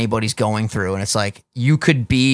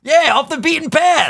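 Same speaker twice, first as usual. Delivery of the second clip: much higher, loud, far-carrying yell; screaming; 3 dB louder than usual. Real, not acted. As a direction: belt the phrase out up high. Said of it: "this bitch is screaming today".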